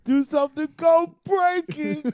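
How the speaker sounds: very high, loud, drawn-out caricature; high, loud, silly comic impression; Tracy Morgan. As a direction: wail it high, chanting the words. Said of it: "You got that Tracy Morgan".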